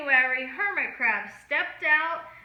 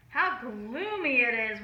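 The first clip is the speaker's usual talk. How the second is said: ordinary delivery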